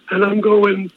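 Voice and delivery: ordinary delivery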